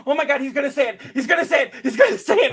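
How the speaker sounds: giddy fan bursting with anticipation; gleeful repeated chant, rising each time; excited anticipation. Retold or imitated. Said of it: "I was like".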